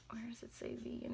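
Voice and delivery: whispered to herself; soft puzzled whisper. Real, not acted. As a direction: whisper while searching the label. Searching the box label.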